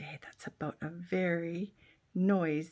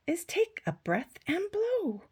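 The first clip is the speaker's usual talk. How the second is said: high moose voice; 12 semitones higher, 3 dB louder than usual. Acted. Moose explains trumpet.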